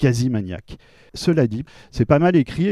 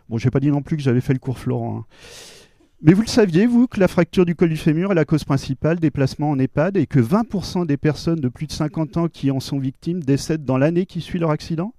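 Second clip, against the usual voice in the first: normal talking voice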